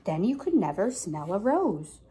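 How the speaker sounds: ordinary talk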